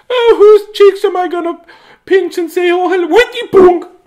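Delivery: very high, loud cooing auntie voice; doting auntie. Retold or imitated. Doting auntie cooing over the kids she visits. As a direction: coo like an aunt about to pinch cheeks. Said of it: "so be".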